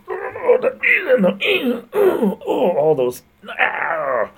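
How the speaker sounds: very high, loud, strained cartoon squeal; hammed-up voice actor. Shown as a demonstration. Streamer acting out Hamill's full-on voice work, high squeal. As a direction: throw yourself into a big cartoon voice. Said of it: "with his hands and he, with his face".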